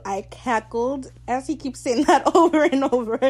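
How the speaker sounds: loud, high, laughing through the words; laughing recap. Real, not acted. Fan recalls cackling at the scene, loud and laughing. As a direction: crack up while telling it. Laughing while recounting what made her cackle.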